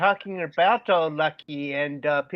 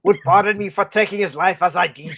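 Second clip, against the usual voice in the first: put-on loud, higher botched German accent; German farmer accent sliding toward Scottish; 4 semitones higher, 7 dB louder than usual. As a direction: push on with the German accent, louder. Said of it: "a German farmer".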